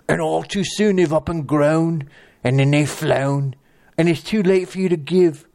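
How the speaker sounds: hammy Flea Bottom Cockney, lingering on each phrase; Flea Bottom accent. Acted. Catfish reciting for the accent contest, Flea Bottom Cockney accent. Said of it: "do the flea bottom accent".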